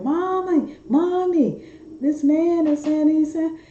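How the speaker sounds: put-on very high-pitched little girl calling for mommy; high mock scream in a small child's voice. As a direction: imitate a child squealing out to her mother. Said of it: "the little girl started screaming".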